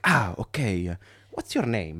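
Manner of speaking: put-on comic Italian-accented English; comically Italian. Italian driver getting acquainted, comic Italian accent.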